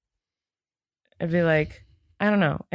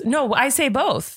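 ordinary delivery

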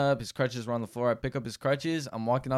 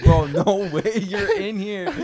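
high, slow, laughing disbelief; frat bro laughing; 8 semitones higher, 7 dB louder, slower than usual. Retold or imitated. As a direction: laugh through the words, bro. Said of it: "I was like".

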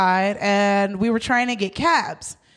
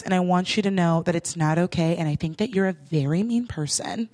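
lower, softer measured lecture; calm speech; 6 semitones lower, 3 dB quieter, faster than usual. Retold or imitated. Speaking to the rude cab driver, calm earnest speech. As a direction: deliver it gently like an earnest talk show lesson. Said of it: "very chill words".

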